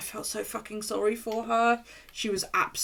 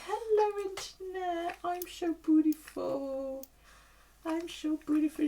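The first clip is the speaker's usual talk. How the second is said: much higher, slow, cutesy dog voice; voicing the dog; 10 semitones higher, slower than usual. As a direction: make the dog preen for the camera.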